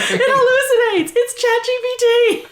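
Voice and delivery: high, loud, laughing outburst; laughing exclamation. Retold or imitated. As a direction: blurt it out through laughter. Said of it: "I was like".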